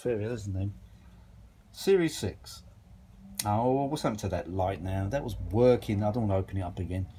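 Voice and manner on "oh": usual speaking voice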